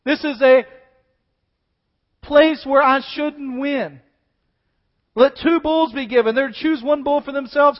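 loud, high, impassioned preaching; preaching at full force. Acted. Preacher booming Elijah's challenge to the people. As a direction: proclaim it to the congregation.

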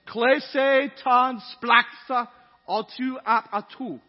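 loud, slow Greek in a German accent. Shown as a demonstration. Preacher reads a Greek verse to congregation, German accent. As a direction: read the Greek phrase haltingly slow, with a heavy German accent. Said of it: "That was a German accent on a Greek phrase".

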